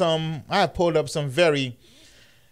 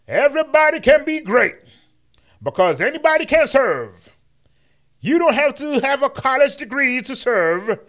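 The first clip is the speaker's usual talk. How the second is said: much higher, loud sermon voice; booming mock King sermon; MLK impression; 9 semitones higher, 5 dB louder than usual. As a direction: preach each line with a rising swell. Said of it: "Quote number six of M L K".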